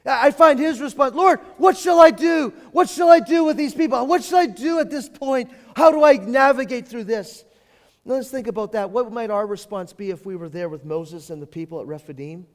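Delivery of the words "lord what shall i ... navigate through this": loud pleading cry, pitch climbing; anguished cry to God. Retold or imitated. Moses crying out to God, loud and desperate. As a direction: Moses begging the Lord for help.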